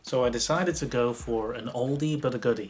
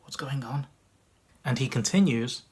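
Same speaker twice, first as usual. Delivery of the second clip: usual speaking voice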